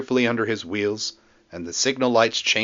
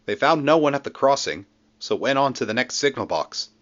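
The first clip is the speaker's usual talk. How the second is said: ordinary delivery, nothing marked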